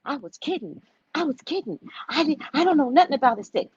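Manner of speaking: acted-out worried retraction; nervous backpedalling. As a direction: back down fast, pleading ignorance. Handyman backing down when confronted.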